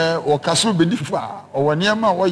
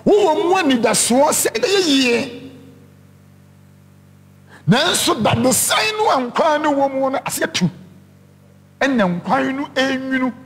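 raised, ringing preacher voice; preaching; 7 semitones higher than usual. Acted. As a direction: drive the point home to the congregation. Preaching the lesson.

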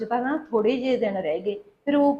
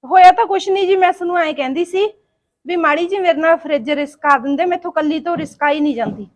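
higher, louder pleading; pleading explanation; 4 semitones higher, 6 dB louder than usual. Acted. Daughter-in-law asking mother-in-law to help move the fridge, pleading. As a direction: explain yourself in a raised, pleading voice.

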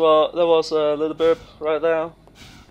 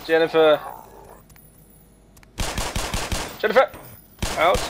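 calling out; much faster than usual. Real, not acted. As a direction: call her name to get her attention. Calling out to an NPC.

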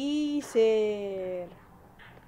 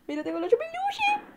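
squeal; 3 dB louder than usual. Real, not acted. Squeals showing the teddy.

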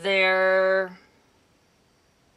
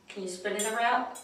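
ordinary delivery

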